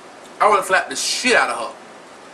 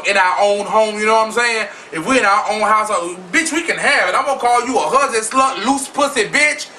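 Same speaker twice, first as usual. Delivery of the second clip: ordinary delivery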